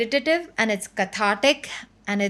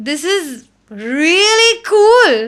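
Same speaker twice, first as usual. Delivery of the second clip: slow, much louder and higher; awed delight; 8 semitones higher, 9 dB louder, much slower than usual. Retold or imitated. Singer recalling her choir moment, slow loud wonder. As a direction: savour every word in wonder. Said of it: "that experience was like a".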